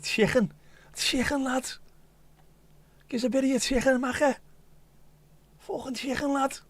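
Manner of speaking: high-pitched Scouse put-on; Scouse accent. Shown as a demonstration. Scouser showing how chicken sounds at home, high Scouse accent. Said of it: "You say it in a Scouse accent".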